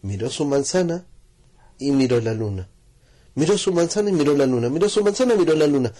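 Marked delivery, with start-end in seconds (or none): 3.3-4.7 fast, higher and louder; fast repetition. A narrator's reading. Narrator speeds repeated glances. 4.7-5.9 fast, higher, louder repeated glances; quickening narration. A narrator's reading.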